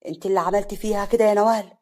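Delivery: loud angry accusation; furious, hurling the blame at her. Acted. Husband accusing Nawal, loud and angry. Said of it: "حسن بغضب".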